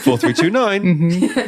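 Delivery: slow phone-number readout; over the phone. Retold or imitated. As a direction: read the number out like on a call. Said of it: "a telephone voice".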